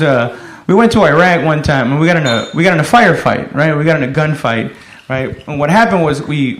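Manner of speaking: ordinary talk, nothing marked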